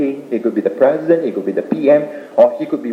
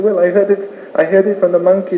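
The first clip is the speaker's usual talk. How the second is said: higher-pitched storybook witness; answering animal; 8 semitones higher than usual. Acted. Fleeing animal answering the lion, lightly acted higher-pitched reply. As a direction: play an animal passing the blame along.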